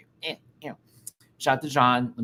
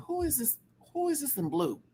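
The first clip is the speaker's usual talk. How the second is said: surprised; 14 semitones higher, 5 dB quieter than usual. Retold or imitated. Surprised question.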